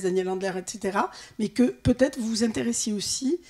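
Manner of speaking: ordinary talk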